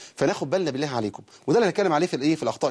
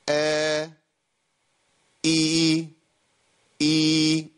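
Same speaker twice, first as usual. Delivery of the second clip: ordinary talk, nothing marked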